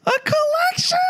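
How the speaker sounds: extremely high, piercing falsetto question; absurdly high mock audition voice. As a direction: push it to the very top of the range. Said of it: "A little higher, please".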